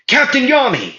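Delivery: high excited cry of a name; calling out. Acted. Everyone calls out to captain.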